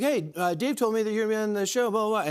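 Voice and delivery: a hint of the talk-show host, hurried; Jimmy. Comedian retelling the host's backstage hello, light impression. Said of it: "I'm sorry, that's an impression".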